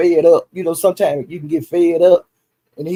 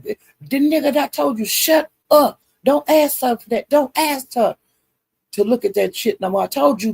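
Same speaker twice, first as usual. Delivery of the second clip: pitched well higher, a hammed-up scolding; mock angry warning at a much higher pitch; retold heated warning; 7 semitones higher than usual. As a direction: imitate Brandon telling him to shut up, voice raised high. Retold heated argument, Brandon speaking.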